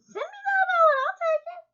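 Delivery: high, squeaky eager voice; excited squeal. Retold or imitated. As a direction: squeal it like you're begging for a free gift. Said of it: "and I'm like".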